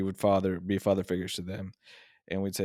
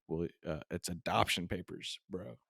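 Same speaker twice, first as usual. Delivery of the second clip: squinting at small print; 2 semitones lower, 8 dB quieter than usual. Pretend. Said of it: "Reading the fine print".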